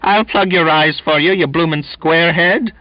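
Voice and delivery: high, loud brawling yell. A narrator's reading. Donkin dancing before the Finn, yelling. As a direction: threaten him at full voice. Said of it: "he yelled".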